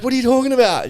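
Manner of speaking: high, loud mock protest; imitated bewilderment. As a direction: demand an explanation, mimicking confusion. Acts out confused housemate's reply.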